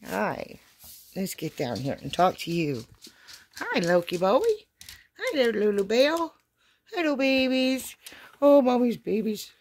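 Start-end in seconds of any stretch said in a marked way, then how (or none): 3.6-9.5 high, sing-song pet voice. Real, not acted. Mom greeting her dogs in high cooing baby talk. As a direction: coo at the dogs like babies.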